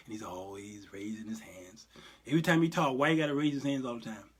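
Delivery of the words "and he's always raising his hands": whisper. Pretend. Mocking movie Jesus.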